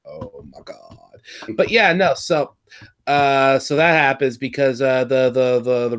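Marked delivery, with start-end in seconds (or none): 0.1-1.0 very low, hushed aside; quietly stunned, under the breath; hushed reaction. Retold or imitated. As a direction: drop to a low, barely voiced murmur. Acted awed reaction to the scene.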